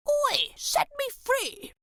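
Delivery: high, slow tiny yell; tiny grub yelling. A narrator's reading. The grub yells to Elray from the web, high and slow. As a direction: stretch it out like a tiny trapped grub.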